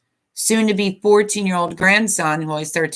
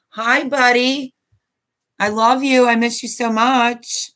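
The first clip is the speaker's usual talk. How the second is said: tender greeting; 6 semitones higher than usual. Real, not acted. Grandma greets grandson on stream, high tender tone. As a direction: greet him like you miss him dearly.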